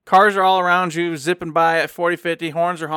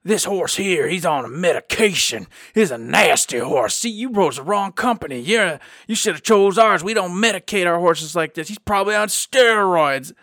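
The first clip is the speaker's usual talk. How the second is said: 1 rival carriage driver; 2 semitones higher than usual. Retold or imitated. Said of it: "he looks at us and he goes".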